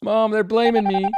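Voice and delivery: mock tattling. Accused player pretending to call mom, mock tattling. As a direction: complain like a kid telling on friends.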